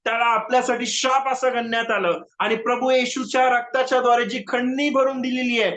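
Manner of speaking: usual speaking voice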